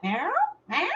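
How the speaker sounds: a hint of a lost cat calling at night; imitating cat meow. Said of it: "they'd wake me up".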